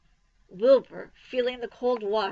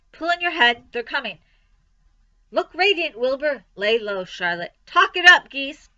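urgent orders; 5 semitones higher, 8 dB louder than usual. A narrator's reading. Sheep giving urgent orders.

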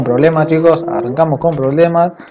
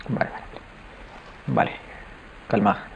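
barely breathed, voiceless; whisper; 5 semitones lower, 15 dB quieter than usual. Real, not acted. Gamer whispering to himself while danger lurks nearby. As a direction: hush it under your breath.

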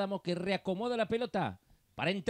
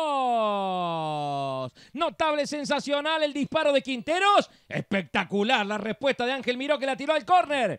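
excited play-by-play; 7 semitones higher, 8 dB louder than usual. Real, not acted. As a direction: shout the shot like a live commentator.

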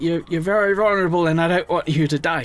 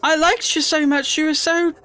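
higher, pleading plea to a dying friend; sad pleading; 11 semitones higher, slower than usual. Real, not acted. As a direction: beg it not to die, half joking. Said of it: "I'm actually really sad".

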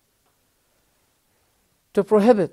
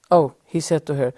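usual speaking voice

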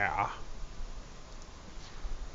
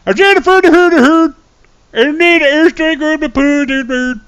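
much higher, louder Swedish Chef calling out enemy moves; Swedish Chef soldier; 8 dB louder than usual. Pretend. Imagining a Milsim stream, calling the flank as the Swedish Chef. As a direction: play a Muppet chef on a military radio. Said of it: "talk like the Swedish chef".

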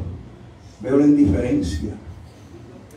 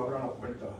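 very soft, lower retold order; hushed, matter-of-fact command; quiet instruction; 3 semitones lower, 14 dB quieter than usual. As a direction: drop right down after the shouting. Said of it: "me dijo".

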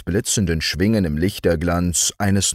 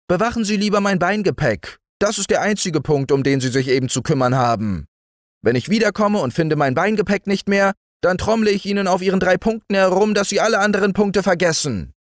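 high, very fast, bossy ant voice; 12 semitones higher, much faster than usual. A narrator's reading. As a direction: threaten the beetle in a rush. Ant threatening beetle.

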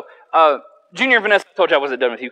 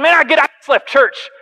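blurted; 9 semitones higher, 5 dB louder than usual. Retold or imitated. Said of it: "I just said".

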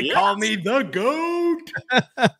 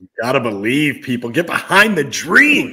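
low, fast hype-man call; deep rally cry to the fans; hype announcer; 10 semitones lower, faster than usual. Acted. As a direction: pump the crowd up like a stadium announcer. Echoes as hype chant.

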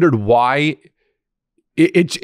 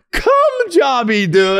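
high, drawn-out squeal through laughter; helpless laughter squeezing the words out slowly; cracking up; much slower than usual. Real, not acted. Cracking up at co-host's joke.

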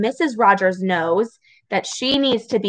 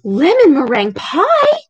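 loud, higher burst of surprise; thrilled story character; delighted exclamation; 5 semitones higher, 5 dB louder than usual. A narrator's reading. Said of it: "she exclaimed".

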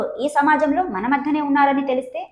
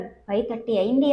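plain reading voice

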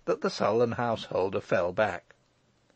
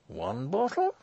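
pondering; 8 semitones higher than usual. A narrator's reading. Morris weighs it in thought.

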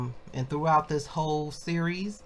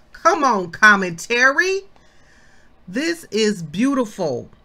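loud, high, fired-up praise; excited; 6 semitones higher, 8 dB louder, slower than usual. Real, not acted. Bible teacher getting passionate over the promise, excited. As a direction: cheer it like good news in church. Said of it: "I get excited".

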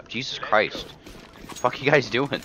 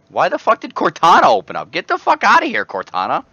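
loud, high furious yelling; angry shout; 11 semitones higher, 7 dB louder than usual. Real, not acted. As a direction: yell at the computer in rage. Game crashes, Cortana pops up.

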